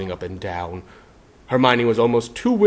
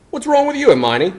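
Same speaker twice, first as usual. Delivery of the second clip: Ron asking; 7 dB louder than usual. Acted. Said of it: "Ron asked".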